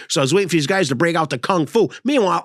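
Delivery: ordinary talk, nothing marked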